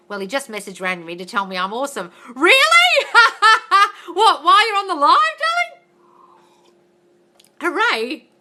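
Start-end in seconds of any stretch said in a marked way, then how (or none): 2.3-3.1 a high, loud, shocked squeal; surprised. Real, not acted. To a viewer whose guy just messaged, a shocked squeal. 3.1-4.1 a loud, high burst of laughter. Real, not acted. Laughing at a viewer's surprising news, a high loud laugh. 4.1-8.3 high, loud, delighted teasing; excited. Real, not acted. Teasing a viewer mid-live, high, loud delight. Thrilled reply to viewer news.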